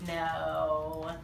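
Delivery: plain reading voice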